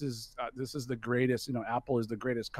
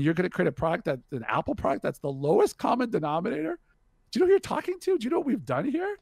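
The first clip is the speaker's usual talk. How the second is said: angry rant; 6 semitones higher than usual. Retold or imitated. Steve Jobs scolding the founder, outraged rant. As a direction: build from stern to outraged disbelief.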